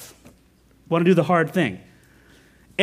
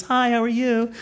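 put-on cheerful shop girl; 8 semitones higher than usual. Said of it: "she goes".

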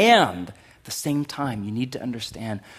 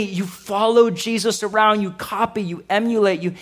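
raised pitch, loud rolling sermon delivery; forceful preaching; 9 semitones higher, 8 dB louder than usual. Real, not acted. Preacher calling believers to follow the pattern, forceful preaching. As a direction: preach it with mounting conviction.

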